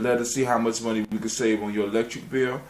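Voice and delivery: usual speaking voice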